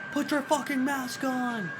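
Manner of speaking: high, loud panicked yell; panicked shout. Acted. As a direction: yell it like the plane is going down. Acting a panicked plane scene.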